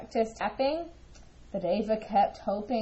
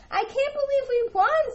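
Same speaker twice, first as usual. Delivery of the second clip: high, excited squeal of disbelief; can't contain the joy; a high, loud gasp of delight; thrilled disbelief; 14 semitones higher, 4 dB louder than usual. Acted. Sister stunned by winning.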